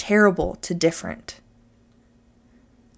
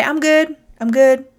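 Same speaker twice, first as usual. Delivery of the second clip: higher and louder, brushing it off; automatic cheery reply; 7 semitones higher, 7 dB louder than usual. Shown as a demonstration. Answering a passing neighbour, loud breezy cheer. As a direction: chirp it back on autopilot. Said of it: "how often do you say".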